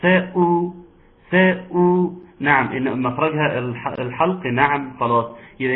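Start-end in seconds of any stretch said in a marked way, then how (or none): 0.0-1.7 ساء with the hamza struck clearly from the throat; ساء said loud with a crisp glottal stop. Shown as a demonstration. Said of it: "حقق الهمزة".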